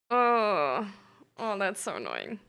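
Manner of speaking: normal talking voice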